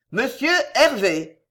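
formal announcement of a visitor; footman announcing. A narrator's reading. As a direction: announce the guest at the door. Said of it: "dit un laquais".

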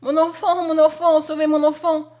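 put-on loud, high, frantic mother crying for her child; desperate cry. A narrator's reading. Mother screaming at the burning house, loud and desperate. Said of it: "À ses cris".

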